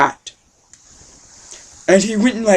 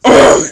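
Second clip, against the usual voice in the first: loud high ugh of pleasure; a loud satisfied groan; delighted grunt; 6 semitones higher, 10 dB louder than usual. Acted. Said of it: "he was like".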